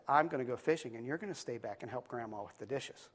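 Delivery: soft, smug little-sister voice; low-key gloating, hushed; quiet blackmail. Acted. Sister quietly blackmails her brother, smug and hushed.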